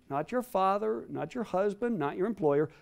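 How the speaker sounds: ordinary delivery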